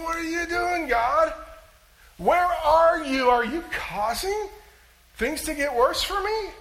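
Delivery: high, loud demanding questions; exasperated outcry. Acted. Believer blaming God for things getting worse, high exasperated outcry. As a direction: shake your finger at God and demand answers. Listener imagined shaking finger at God.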